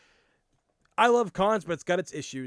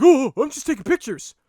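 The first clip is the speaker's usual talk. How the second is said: higher and louder, playing dumb and innocent; mock innocent photographer; 8 semitones higher, 10 dB louder than usual. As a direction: mimic the creep brushing it off with a high, loud, innocent voice. Imitating the intrusive photographer.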